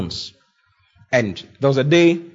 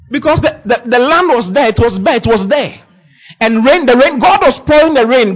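higher, louder preaching of the parable; preaching at full force; 6 semitones higher, 4 dB louder than usual. Acted. Preacher pressing the farmer parable on his listeners, rising fervour. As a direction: drive the farm story home to the congregation.

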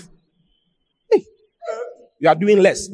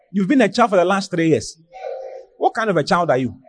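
normal talking voice

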